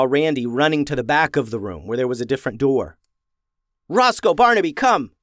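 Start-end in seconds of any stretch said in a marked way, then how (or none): 3.9-5.1 loud call to the dogs; yelling. A narrator's reading. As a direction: call them in with a yell. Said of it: "yelled Ben".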